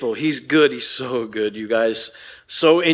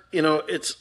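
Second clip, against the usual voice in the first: normal talking voice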